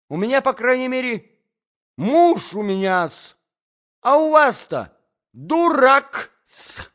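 very high, very loud tirade; outraged lady screeching in a quarrel; shrieking Natalya. A narrator's reading. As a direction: hurl insults in a squealing pitch.

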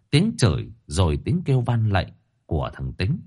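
plain reading voice

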